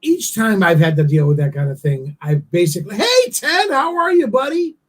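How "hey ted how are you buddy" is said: high, bright fake-friendly hello; mock chummy dodge of the subject; chirpy hey-buddy, much higher; overly cheerful greeting. Retold brushing-off greeting.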